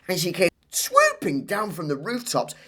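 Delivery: put-on high, excited child telling a wild story; Claire's tall tale. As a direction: spin the tale breathlessly.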